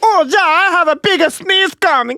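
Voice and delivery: hammed-up German sneezing man, very high; a thick mock German accent in a squeaky voice; silly cartoon German accent. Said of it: "that fucking German accent".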